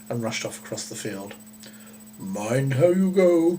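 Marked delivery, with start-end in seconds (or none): 2.2-3.6 slow, loud call from the cow; cow's call. A narrator's reading. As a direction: call it after her across the field. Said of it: "came the cow's cry".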